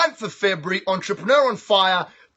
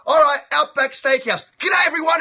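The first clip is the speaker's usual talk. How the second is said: mock ad pitch; 5 semitones higher than usual. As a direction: sell it like a cheesy commercial.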